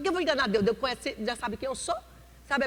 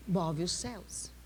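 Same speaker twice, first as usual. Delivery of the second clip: hushed echo; 6 semitones lower, 7 dB quieter, slower than usual. Acted. Soft echo after amen.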